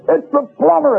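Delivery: high, bellowing shout; top of his voice. Retold or imitated. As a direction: yell it out, fed up. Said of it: "at the top of his voice".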